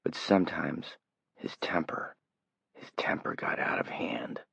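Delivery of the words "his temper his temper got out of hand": hushed whisper of the words. A narrator's reading. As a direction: breathe it out as a secret. Narrator whispers repeated phrase.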